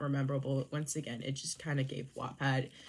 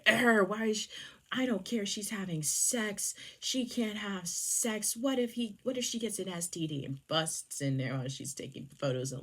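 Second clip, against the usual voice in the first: higher-pitched, exasperated jealous rant; mock pissed-off; 5 semitones higher than usual. Jealous man ranting about her taking photos, mock pissed-off. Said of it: "he gets absolutely pissed".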